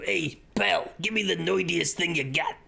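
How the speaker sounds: brash, put-on New Yorker; New Yorker accent. Gift buyer kicking in shop door, mock New Yorker accent. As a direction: barge in as a hammed-up New Yorker. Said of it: "the New Yorker accent in my head".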